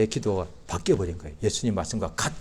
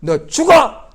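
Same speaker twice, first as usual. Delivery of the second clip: loud, high barked order; harsh command; 13 semitones higher, 13 dB louder, much slower than usual. Retold or imitated. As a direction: snap it like a cruel master. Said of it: "이게 아니라".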